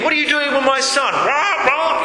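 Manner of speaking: high, squawking parent bird; scolding blackbird. Acted. Preacher acts blackbird defending its young, high indignant squawk. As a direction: squawk in protest like an angry bird. Acted angry swooping blackbird.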